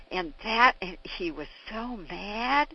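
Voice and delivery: normal talking voice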